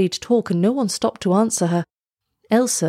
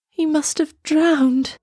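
high, slow lament; distressed cry; 8 semitones higher, much slower than usual. A narrator's reading. Girl fears the prince has drowned, high distressed cry. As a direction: cry it out in a higher, drawn-out voice. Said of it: "she cried".

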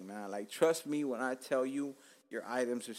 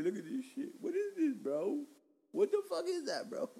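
mock shock; 14 semitones higher than usual. Real, not acted. Shocked at gray hairs on mic.

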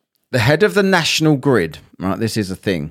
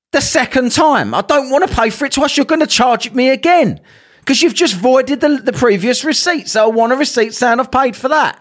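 raised, higher-pitched exasperated complaint; heated retelling of shop row; exasperated retort; 6 semitones higher than usual. Retold or imitated. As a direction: push back hard, fed up. Heated retold argument with cashier.

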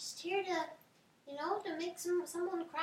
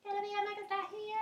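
higher, faster mocking copy; mocking echo; 5 semitones higher, 3 dB quieter, faster than usual. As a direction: parrot her back to tease. Mocking repeat, fast and higher.

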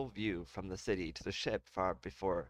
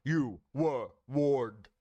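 louder, slow booming threat; 4 semitones higher, 7 dB louder, much slower than usual. Acted. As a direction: boom it slowly and loud. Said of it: "I can do that better".

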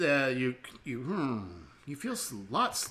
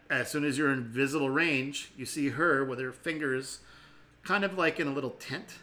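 normal talking voice

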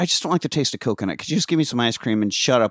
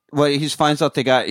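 ordinary talk, nothing marked

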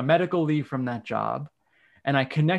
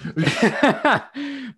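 loud, high burst of laughter; 11 semitones higher, 5 dB louder than usual. Real, not acted. Speaker amused by friend voicing her husband, laughing high.

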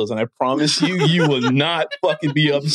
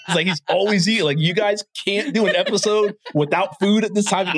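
ordinary talk

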